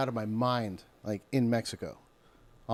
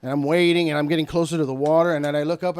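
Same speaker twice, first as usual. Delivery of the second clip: normal talking voice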